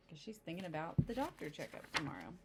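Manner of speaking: ordinary delivery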